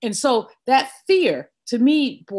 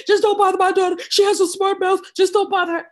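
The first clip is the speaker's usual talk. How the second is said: mother's voice; 8 semitones higher, faster than usual. Retold or imitated. Mother begging the officer, pleading and raised. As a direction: plead for your daughter like her mother.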